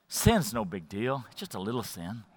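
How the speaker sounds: very low, hushed and coaxing; quiet tempter in your ear; tempting inner voice. Acted. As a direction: play it down in a soft murmur. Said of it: "what it's gonna sound like in your ears".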